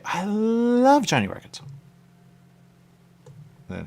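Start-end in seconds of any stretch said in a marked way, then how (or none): 0.0-1.6 high, slow, put-on voice; celebrity endorsement voice. Actor promoting the restaurant, high and slow. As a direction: sell it like a paid plug. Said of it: "He would say".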